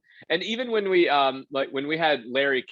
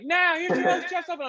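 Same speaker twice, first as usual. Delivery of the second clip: much higher, big announcer call; announcer intro. Retold or imitated. Announcer bringing the host onstage. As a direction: sell the host's entrance.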